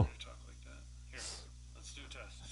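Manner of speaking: very soft whisper; whisper test. Shown as a demonstration. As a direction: barely whisper it into the mic.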